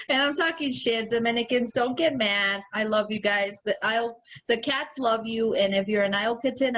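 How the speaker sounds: usual speaking voice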